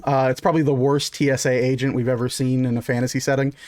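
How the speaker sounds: ordinary talk, nothing marked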